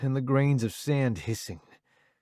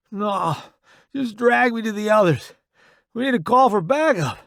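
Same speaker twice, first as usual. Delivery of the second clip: high, loud injured soldier; pained, pressing plea; strained urgency; 10 semitones higher, 6 dB louder than usual. A narrator's reading. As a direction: urge a comrade through the pain. Injured soldier urging his comrade on.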